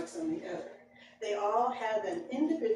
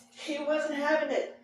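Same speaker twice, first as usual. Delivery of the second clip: normal talking voice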